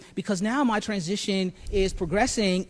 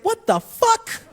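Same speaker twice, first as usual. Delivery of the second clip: high, loud, drawn-out shriek of disbelief; mock-scandalized yelp, stretched for laughs; shocked outburst; 6 dB louder, slower than usual. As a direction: act out a floored mother exploding. Mother's shock at the news.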